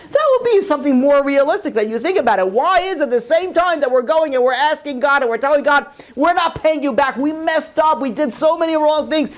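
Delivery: high-pitched, urgent sermon delivery; fervent rabbi driving the point home; impassioned preaching. Real, not acted. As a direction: press the moral on the congregation. Preaches the moral, challenging listeners.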